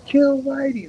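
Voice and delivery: high, drawn-out crowd-rousing holler; Chris Farley impression. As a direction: belt it out high, imitating a movie yell. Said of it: "singing to the whole crowd, he goes".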